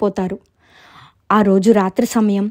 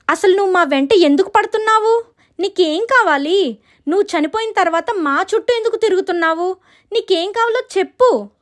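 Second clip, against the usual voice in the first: very high, urgent questioning; acted distressed sister, pitched up; frightened confrontation; 10 semitones higher than usual. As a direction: demand answers from the ghost in alarm. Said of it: "చాలా భయపడతారు".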